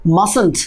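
Mustn't, said slowly as masent; mustn't contracted. Shown as a demonstration. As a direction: draw out Mustn't, dropping the t sound in the middle. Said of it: "আমরা এটাকে কি বলি". (Mustn't ধীরে, মাসেন্ট উচ্চারণে)

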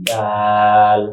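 দাল with a long, held aa; pronunciation demo. Shown as a demonstration. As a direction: model দাল for a pupil, vowel stretched. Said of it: "উচ্চারণ শেখার জন্য".

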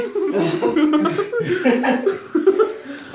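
high breathless laugh from the cold; shocked laugh. Real, not acted. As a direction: laugh out the shock of ice water. Laughing in ice bath.

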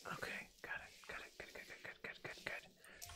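soft unvoiced murmuring while working; whispered self-talk. Real, not acted. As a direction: whisper good, good as it goes right. Painting bathroom, talking to himself.

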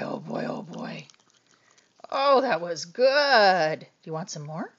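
ordinary talk, nothing marked

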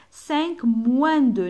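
Cinq moins deux slow and loud; slow loud model. Shown as a demonstration. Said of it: "M avec wa". (Cinq moins deux ببطء وبصوت عالٍ)

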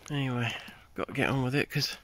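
ordinary delivery

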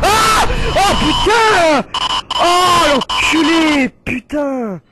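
long, high, loud swearing; high-pitched jump-scare outburst; shocked screaming. Real, not acted. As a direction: scream the curses out in fright, drawing them out.

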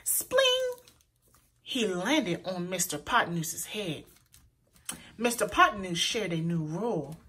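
ordinary delivery, nothing marked

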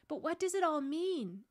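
put-on bewildered child's voice; puzzled girl. A narrator's reading. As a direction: beg to understand what happened. Susan puzzled, asking for explanation.

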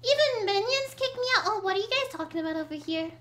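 ordinary talk, nothing marked

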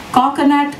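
lightly voiced little hawker crying his wares; yelping hawker boy. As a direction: sing out Coconut like selling on the lakeside. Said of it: "yelped a hawker".

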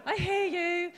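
high, laughing I hear you; laughing acknowledgement. Retold or imitated. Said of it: "I laughed and I went".

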